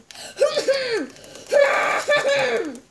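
sudden high loud yell; a real startled yell; startled shout. Real, not acted. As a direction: yelp in surprise.

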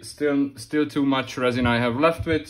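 usual speaking voice

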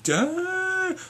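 high drawn-out exclamation; awed damn. Retold or imitated. Fan seeing the idol, awed damn. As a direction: gasp it out in awe. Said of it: "I'm like".

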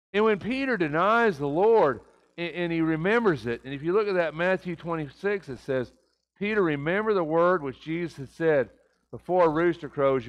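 preacher building to full force; fiery preaching. Acted. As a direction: preach it out loud to the congregation.

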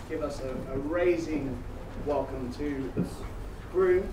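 airline cabin announcement voice; mock in-flight PA announcement; flight attendant. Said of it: "his very own address to the passengers".

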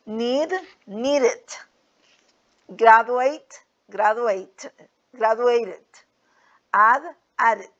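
needed, graduated, added each said with a clear extra "id" syllable at the end; teacher stressing the extra syllable in needed and added. Shown as a demonstration. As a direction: spell out the -ed ending as its own syllable. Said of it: "como una E D al final".

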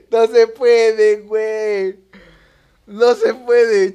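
slow, high, loud drawn-out wail; loud lament. Real, not acted. Player failing the course, long loud lament. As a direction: wail in defeat.

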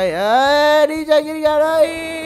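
high, loud scream of falling. Acted. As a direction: cry out high as you drop. Falling from the fan.

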